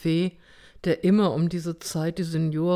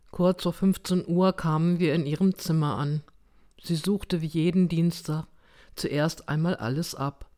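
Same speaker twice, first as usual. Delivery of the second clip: ordinary delivery, nothing marked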